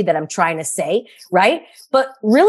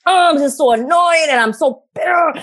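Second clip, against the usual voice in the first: higher-pitched, hammed-up annoyed rant; mock tantrum; 7 semitones higher than usual. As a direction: replay the tantrum lightly, voice pitched up. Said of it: "I threw a tantrum".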